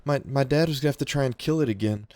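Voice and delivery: put-on a shy kid confessing; nervous boy.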